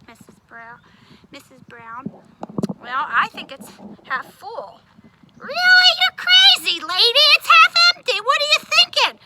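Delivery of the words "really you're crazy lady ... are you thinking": much higher, louder scoffing outburst; rude tone. Acted. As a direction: scoff at the grown-up. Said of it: "Oh, my tone".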